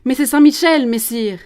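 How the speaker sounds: high, loud protest; crying out. Acted. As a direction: cry out in indignant defence. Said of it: "Jeanne crie". (cri de protestation, aigu et fort)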